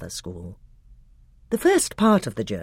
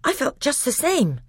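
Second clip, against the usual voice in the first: put-on higher, uneasy boy's voice; 13 semitones higher than usual. Edmund tells the others he felt it too, uneasy. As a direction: admit it, shaken.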